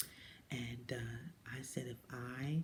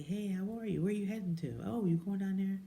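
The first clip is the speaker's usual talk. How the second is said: higher, bright passing greeting; cheerful lady neighbor; 7 semitones higher, 4 dB louder than usual. Retold or imitated. Retelling a neighbor's greeting, higher and friendly. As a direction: greet her friendly and high as you pass. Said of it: "And she would say".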